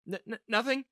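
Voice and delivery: flustered little "n-nothing"; nervous stammer. A narrator's reading. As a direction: trip over the word, covering up. Caught muttering, covers up.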